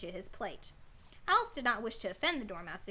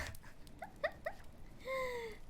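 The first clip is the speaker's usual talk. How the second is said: high crying laugh at herself; 13 semitones higher, 9 dB quieter than usual. Real, not acted. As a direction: laugh until it squeaks.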